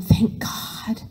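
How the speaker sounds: hushed, whispery moan of relief; soft moan. A narrator's reading. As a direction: moan it softly, almost voiceless. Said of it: "she moaned softly".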